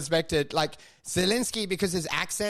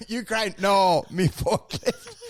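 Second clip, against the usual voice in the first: slow mock Ukrainian accent; broken English, slow; slower than usual. Mocking Zelensky's English in a heavy Ukrainian accent. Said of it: "would go".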